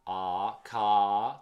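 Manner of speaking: long open "A", then "ca" loud and drawn out; rounded A. Shown as a demonstration. Teacher isolating the vowel for learners, rounded "ca". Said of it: "El A es mucho más redondeado".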